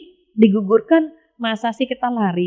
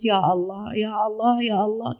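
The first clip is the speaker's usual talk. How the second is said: soft, hushed pleading to God; a gentle, mock-tearful plea; weeping prayer; 7 dB quieter than usual. Preacher shows mothers crying to God, softly pleading. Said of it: "kita nangis".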